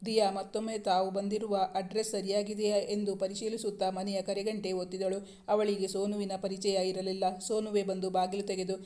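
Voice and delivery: usual speaking voice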